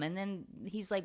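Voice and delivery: normal talking voice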